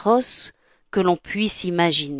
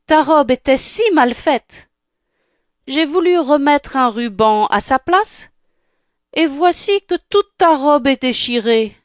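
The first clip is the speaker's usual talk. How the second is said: stepmother voice; 5 semitones higher, 3 dB louder than usual. Acted.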